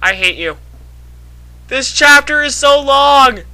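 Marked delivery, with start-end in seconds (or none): 1.7-3.4 high, drawn-out whine; slow, high-pitched moan of protest; whiny complaint. Real, not acted. Complaining about having to read.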